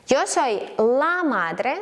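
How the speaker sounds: very slow, drawn-out Spanish model phrase; slow teaching delivery. Shown as a demonstration. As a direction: stretch each word for learners. Slow model sentence for learners.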